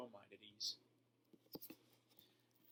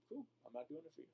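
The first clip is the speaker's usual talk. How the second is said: softer, unbothered, even reply; calm and composed; 4 semitones higher, 6 dB quieter, much faster than usual. Shown as a demonstration. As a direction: shrug off the insult calmly. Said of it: "Notice how much more calm and composed I am".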